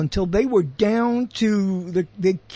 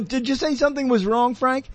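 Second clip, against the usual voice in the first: higher-pitched mock questioner; inner voice questioning; 6 semitones higher than usual. Radio host heckling himself mid-monologue, mock questioning. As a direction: pipe up as the doubting inner voice.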